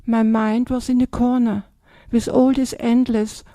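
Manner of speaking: ordinary talk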